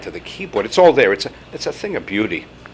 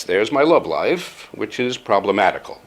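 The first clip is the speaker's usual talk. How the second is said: ordinary delivery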